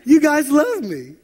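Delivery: slow, high, fake-touched cooing; mock sweet. Bruised kid joking to the older guys, high mock-touched coo. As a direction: coo it like a beating was a hug. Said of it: "Like, I was".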